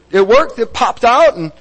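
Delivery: ordinary talk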